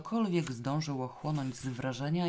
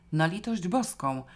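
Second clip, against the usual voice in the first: loud cry of sudden panic; loud, panicked exclamation; 4 semitones higher, 6 dB louder than usual. A narrator's reading. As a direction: cry out in alarm. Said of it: "krzyknęła".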